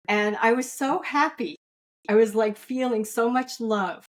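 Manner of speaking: usual speaking voice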